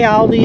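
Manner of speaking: "Aldi" said as AL-dee; "Aldi" starting like the name Al; incorrect US variant. Shown as a demonstration. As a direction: say "Aldi" with the a of "pal". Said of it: "It's incorrect".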